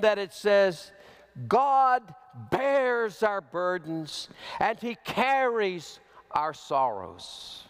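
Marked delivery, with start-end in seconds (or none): 1.5-7.6 God bears our burdens read slowly with weight; slow solemn quote. Real, not acted. Preacher quoting scripture to comfort the church, slow and weighty. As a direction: let each word land slowly. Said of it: "language here is forceful".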